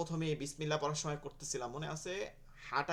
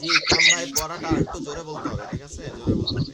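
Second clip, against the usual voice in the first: urging louder; 13 dB louder than usual. Real, not acted. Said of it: "teacher urging a student".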